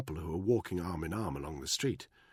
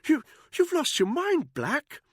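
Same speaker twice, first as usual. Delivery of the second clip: high, loud, nervous stammer; stammering Buckingham; 7 dB louder, slower than usual. A narrator's reading. Buckingham cornered by Danny, stammering high. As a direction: trip over the words in a high upper-class voice.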